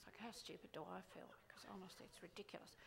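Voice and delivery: hushed confiding whisper; whispered aside. Acted. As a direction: whisper it, conspiratorial.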